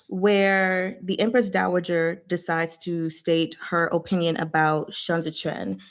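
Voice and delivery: ordinary talk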